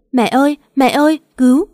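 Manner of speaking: high, loud crying call for mom; panicked cry from the bathroom; sobbing scream for help. Acted. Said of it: "vừa khóc nấc vừa gào lên". (gào khóc hoảng loạn, giọng cao và to)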